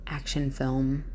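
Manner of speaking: ordinary talk, nothing marked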